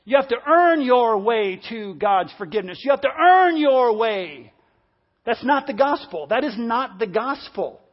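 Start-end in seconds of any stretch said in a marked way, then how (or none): 0.1-4.5 loud, insistent legalistic preacher imitation; mock-stern proclamation, raised voice; mock preachy demand. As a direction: hammer it out like a demanding churchman, stretching the key words. Mimics guilt-driven church teaching.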